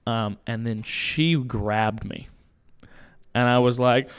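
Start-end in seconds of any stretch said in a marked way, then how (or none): none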